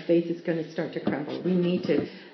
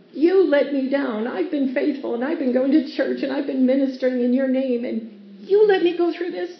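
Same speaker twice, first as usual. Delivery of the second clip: aggrieved complaint; 7 semitones higher, 6 dB louder than usual. Retold or imitated. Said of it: "we treat God like that".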